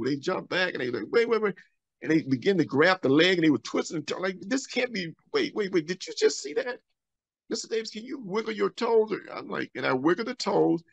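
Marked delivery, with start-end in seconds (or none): 1.1-1.5 high, urgent repeated wait; doctor halting the amputation in shock. Retold or imitated. 4.4-9.1 much higher, amazed questioning; doctor asking the patient to move his toes, amazed; disbelieving doctor. Retold or imitated.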